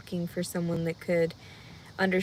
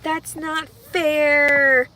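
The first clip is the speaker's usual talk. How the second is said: whine; 7 semitones higher, 9 dB louder, much slower than usual. Real, not acted. Whining complaint.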